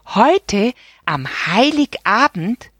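high, slow incredulous check; checking in disbelief. A narrator's reading. Boy checking the birthday date, high disbelief. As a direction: make sure you heard right. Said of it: "vergewisserte er sich".

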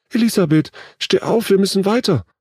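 put-on very high-pitched urgent girl's cry; desperate cry. A narrator's reading. Lene urges her fallen friend onward, desperate cry. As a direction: plead loudly at a high pitch. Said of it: "schrie Lene".

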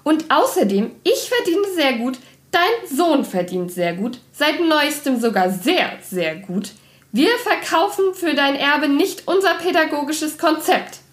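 high, emphatic woman's retort; high-pitched indignant telephone tirade without shouting; calling into phone. A narrator's reading. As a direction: argue back down the phone, pitch up. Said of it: "rief sie ins Telefon".

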